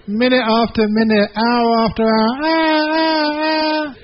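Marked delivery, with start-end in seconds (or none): 2.4-3.9 very high, long mock bray; a trapped donkey wailing on and on; donkey crying. Donkey crying hour after hour in the well. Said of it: "He started crying".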